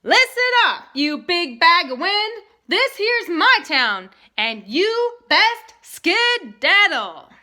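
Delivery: loud, high, slow cowboy holler. Acted. The boy hollers at the tornado to leave town, loud and slow. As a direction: warn the wind at full voice.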